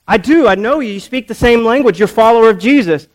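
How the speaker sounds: high, loud acted insistence; insistent accusation. Accuser confronting Peter a third time, loud and insistent. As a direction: press the charge hard, speeding up at the end.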